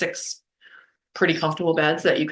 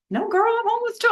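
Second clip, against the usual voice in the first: a sudden very high, squeaky imitation of her friend. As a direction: squeal it up in a mock girly voice. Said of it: "She goes".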